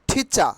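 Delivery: ঠিচা with puffed T and no R at the end; short ঠিচা, ending on an open "cha"; aspirated T, silent R. Shown as a demonstration. Teacher modelling the correct word, aspirated T, silent R. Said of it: "টিচারের উচ্চারণ হয়".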